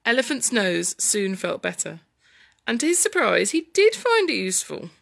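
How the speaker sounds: put-on lively story narration for children; dramatic storytelling. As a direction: read the tussle with big dramatic swings.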